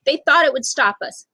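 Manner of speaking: plain reading voice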